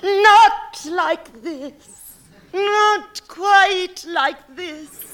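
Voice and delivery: loud, slow lovelorn sigh; drawn-out mock passion; swooning slow.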